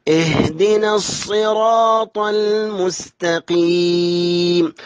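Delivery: slow measured tajweed chant; Quran recitation. Shown as a demonstration. Teacher models a verse for students, slow tajweed chant. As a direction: recite slowly with long held vowels.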